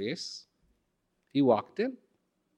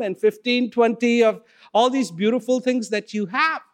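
higher and louder, tumbling out orders in excitement; eager greedy order; 8 semitones higher, 4 dB louder than usual. Acted. Customer to shopkeeper, wanting everything for family and friends, eager and loud. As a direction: grab it all before it's gone.

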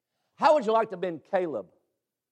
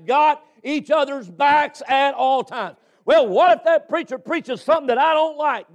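high-pitched, driving sermon delivery; full-force preaching; 8 semitones higher, 4 dB louder than usual. Real, not acted. Preacher urging the men to back each other, full-force preaching. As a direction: preach it hard, stretching the key words.

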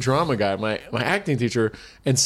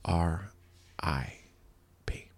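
softer, slow letters, mock choked up; mock tearful; 7 semitones lower, 12 dB quieter, slower than usual. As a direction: spell it like holding back tears. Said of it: "almost tearing up".

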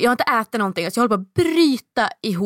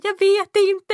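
frantic repeat; 12 semitones higher, 4 dB louder, slower than usual. Retold or imitated. Retold phone call with agent.

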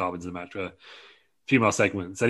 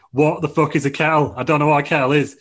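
loud, higher-pitched mock exasperation; really annoyed; 5 semitones higher, 7 dB louder than usual. Dom to Kofi about the unknown kettle, annoyed. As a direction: protest in acted annoyance. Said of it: "Dom's getting really annoyed".

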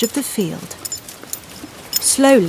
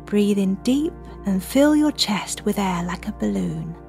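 soft spa whisper; 6 dB quieter than usual. Acted. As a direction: guide the children calmly to breathe. Leads children's breathing meditation.

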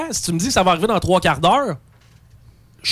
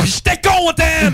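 higher, louder, slow mock-happy shout; sarcastic shout; 8 semitones higher, 4 dB louder, slower than usual. Customer mocks his own happiness, loud sarcastic shout. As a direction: yell it sarcastically, stretched out.

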